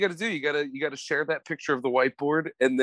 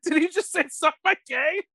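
much higher, stunned disbelieving voice; incredulous classmate. Retold or imitated. Classmate turning around in disbelief, high incredulous voice. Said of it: "he turned around and he goes".

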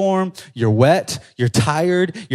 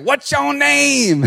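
high, drawn-out mock question; TikTok imitation; 8 semitones higher, much slower than usual. Imitating a TikTok voice asking a name, high and drawn out. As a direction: stretch it out high like a viral clip. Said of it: "What TikTok is like".

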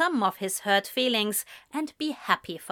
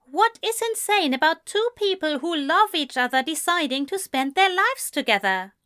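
higher-pitched, affronted disbelief; indignant counter; 8 semitones higher, 4 dB louder, faster than usual. A narrator's reading. Man defends his engagement to friend, indignantly countering. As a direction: counter him, between anger and confusion. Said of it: "Ezra countered, unsure if he should be angry or confused".

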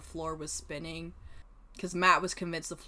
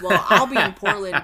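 laughing; 13 dB louder than usual. Retold or imitated. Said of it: "And I was all like".

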